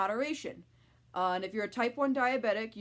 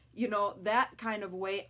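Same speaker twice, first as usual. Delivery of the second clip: ordinary talk, nothing marked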